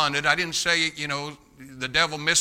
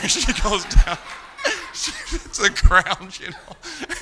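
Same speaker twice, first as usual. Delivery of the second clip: high, slow, helpless laughter through the words; words breaking into real laughter; laughing while talking; 7 semitones higher, 3 dB louder, much slower than usual. Real, not acted. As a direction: crack up telling your own story. Cracking up telling his own story.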